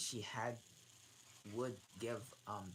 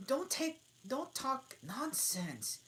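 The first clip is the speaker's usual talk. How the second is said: put-on higher girl voice, slower and firmer; bolder Alice; 5 dB louder, slower than usual. Alice scolding the dormouse, bolder and higher. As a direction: push back a little bolder. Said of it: "said Alice more boldly".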